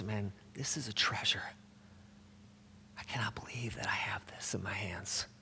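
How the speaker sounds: near-whisper of wonder; hushed awe. Acted. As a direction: whisper it softly, as if holding a treasure. Acts out awe at holding scripture.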